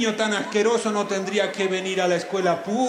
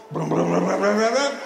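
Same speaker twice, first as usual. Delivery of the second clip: rolled lips rumbling brum; stomach rumble; 4 dB louder than usual. Acted. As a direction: rumble like a growling belly. Storyteller making stomach rumble noise. (gruñido de tripas con los labios)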